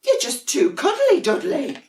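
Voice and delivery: very high, sing-song little voices; sweet sibling penguins. Acted. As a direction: coo it like cuddly little penguins. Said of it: "came the reply".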